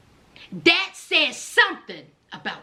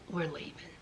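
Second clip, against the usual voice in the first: lower, much softer firmness; quiet firm whisper; 8 semitones lower, 7 dB quieter than usual. Pretend. Son quietly telling his mother they are leaving, hushed. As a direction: quietly shut it all down. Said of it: "look at his mother and say".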